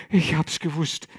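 lamenting. Acted. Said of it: "klagte Gregor sich weiter an".

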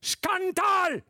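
very high, very loud outraged cry; shout. A narrator's reading. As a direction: hurl the word at them. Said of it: "schrie er".

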